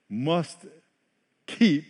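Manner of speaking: ordinary delivery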